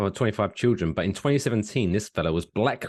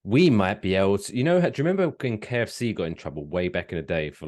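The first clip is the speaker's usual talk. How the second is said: ordinary talk, nothing marked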